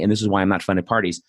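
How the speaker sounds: ordinary talk, nothing marked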